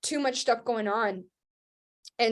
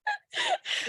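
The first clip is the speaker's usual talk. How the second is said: rueful laugh; 3 dB quieter than usual. Real, not acted. Ironic laugh at pandemic timing.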